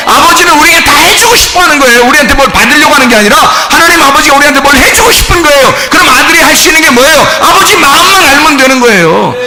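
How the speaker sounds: high, forceful pulpit delivery; impassioned preaching. Real, not acted. Preacher explaining the Father's heart, fervent preaching. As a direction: drive the point home to the congregation.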